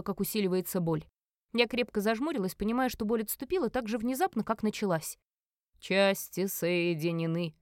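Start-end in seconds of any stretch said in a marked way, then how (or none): 5.8-7.5 slow, low proclamation; solemn announcement. Acted. Merlin declares the ritual done, slow and solemn. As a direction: pronounce it with ritual weight. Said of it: "громко объявил Мерлин".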